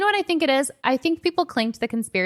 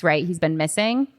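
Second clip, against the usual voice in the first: ordinary delivery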